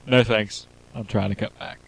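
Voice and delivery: quiet. Pretend. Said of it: "repeats the line, softer".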